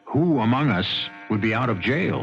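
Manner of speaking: ordinary talk, nothing marked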